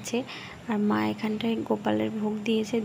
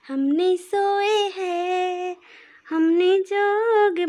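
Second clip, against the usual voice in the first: put-on baby talk; 8 semitones higher, 5 dB louder, much slower than usual. Cooing to the baby.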